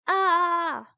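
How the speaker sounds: long, high-pitched "Ah" of fright; frightened yell. A narrator's reading. Teddy sees the floating bone, frightened yell. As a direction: yelp high at moderate volume. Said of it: "yelled Teddy".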